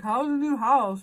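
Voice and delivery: slow, high, shifty neighbor; sketchy neighbor voice. Retold or imitated. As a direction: play him cagey and suspicious. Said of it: "He seemed, like, real sketchy".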